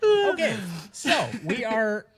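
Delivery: high laughter spilling into a word; laughing. Real, not acted. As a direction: still cracking up. Still laughing after trailer joke.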